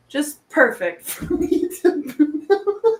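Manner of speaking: slow, high, dissolving into laughter; laughing through it. Retold or imitated. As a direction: let the quote melt into giggles. Quoting daughter, laughing slowly.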